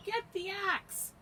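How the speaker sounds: high, loud, stretched-out order; Jack's high-pitched yell; urgent yell. Acted. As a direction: shout it desperately to someone far below. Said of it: "he yelled".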